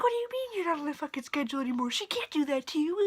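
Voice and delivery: high, rapid indignant rant; worked-up mock mom tirade; mad mom. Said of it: "She was so mad".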